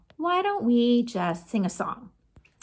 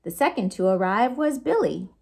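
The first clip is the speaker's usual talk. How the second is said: ordinary delivery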